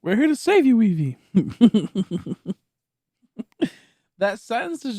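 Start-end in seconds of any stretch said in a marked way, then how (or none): 0.0-1.2 loud, high heroic declaration; loud high hammed heroics; mock heroic. 1.2-2.5 loud high cackle; hearty loud laugh. Real, not acted. Laugh after bit.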